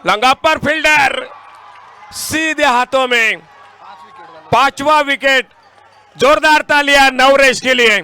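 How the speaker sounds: shouting a wicket call, high and loud; excited cricket commentary. Real, not acted. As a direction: yell it as the catch is taken. (उत्साहित cricket commentary)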